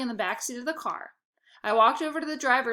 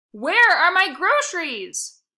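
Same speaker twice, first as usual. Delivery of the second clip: shrieking, much louder and higher, slowed right down; screech; 8 semitones higher, 10 dB louder, slower than usual. Acted. Missus Prince screeching at the bag boy, slow furious shriek. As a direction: screech it word by word in fury. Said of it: "she screeched".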